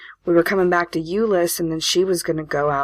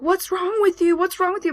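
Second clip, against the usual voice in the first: much higher, frantic imitation of a scared kid; panicked brother. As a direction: shake her and demand what's wrong. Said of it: "He's like".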